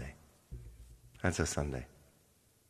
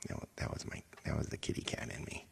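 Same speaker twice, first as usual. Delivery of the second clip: playful stage whisper about the tummy noise; 8 semitones lower, 14 dB quieter, faster than usual. Pretend. Singer whispering to viewers about her gurgling stomach, playful whisper. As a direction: breathe it out like a silly secret.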